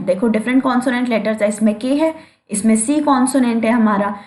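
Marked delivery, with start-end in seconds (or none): none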